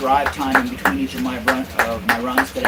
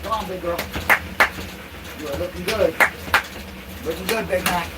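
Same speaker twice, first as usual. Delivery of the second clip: high, soft sing-song to the dog; doggy talk; 5 semitones higher, 4 dB quieter than usual. Real, not acted. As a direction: coo gently at the running dog. Coos to the dog running.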